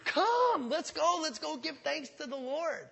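high, excited call to come along; eager invitation. Pretend. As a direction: lift the voice like inviting friends. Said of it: "an invitation going out, say".

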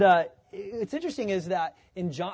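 usual speaking voice

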